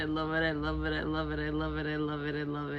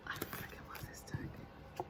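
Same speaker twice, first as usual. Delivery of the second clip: slow hushed whisper; real whispered delight; 15 dB quieter, slower than usual. Real, not acted. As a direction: breathe it out like a secret. Gushing over the deck.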